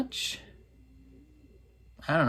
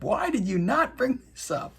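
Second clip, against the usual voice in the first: high, exasperated mock complaint; mock pissed-off; 10 semitones higher than usual. Recapper as the general who was told too late, mock pissed-off. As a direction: play at being annoyed, pitched high. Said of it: "I would be pissed".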